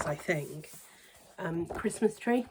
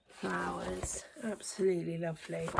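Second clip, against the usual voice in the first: whisper; 6 dB quieter than usual. Real, not acted. Whispered admiration.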